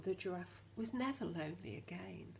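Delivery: ordinary delivery, nothing marked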